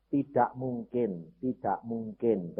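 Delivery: pelan, lebih rendah dan lirih; pasrah. Retold or imitated. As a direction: ucapkan lambat-lambat dengan nada pasrah. Retold resigned answer, drawn out. (pelan dan pasrah)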